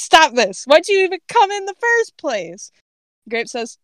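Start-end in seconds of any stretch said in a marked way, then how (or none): 0.0-2.7 exasperated outburst, climbing much higher; growled demand. A narrator's reading. Cypher snaps at Grape for coming, exasperated growled demand. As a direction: demand it, voice shooting up. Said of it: "Cypher just growls and says".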